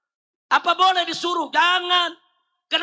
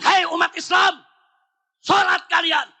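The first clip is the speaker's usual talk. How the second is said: loud, higher barked order; stern command; 5 semitones higher, 5 dB louder than usual. Retold or imitated. Preacher ordering Muslims to pray, stern loud command. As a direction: command them, no softness. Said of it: "Itu namanya nyuruh".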